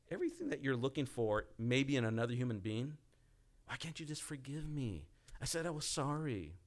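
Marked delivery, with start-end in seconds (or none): 3.7-6.6 raised, pleading plea for forgiveness; a higher, pleading take on the asking; begging. Retold or imitated. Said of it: "you're begging someone to forgive you".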